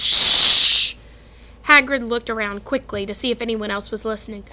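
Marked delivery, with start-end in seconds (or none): 0.0-0.9 sharp loud shush; loud hushing shush. Acted. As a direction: hush them urgently. Hagrid hushing them.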